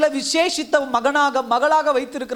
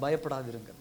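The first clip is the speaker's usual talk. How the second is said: much softer and lower, tender voice of God; gentle reassurance; 10 semitones lower, 13 dB quieter than usual. Acted. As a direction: hush it like a comforting promise. God's comforting word quoted softly.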